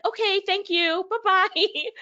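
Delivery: high, singsong thanks and goodbye; breezy dismissal. Retold or imitated. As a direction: send them off in a high, mock-sweet voice. Acting out curt end of exchange.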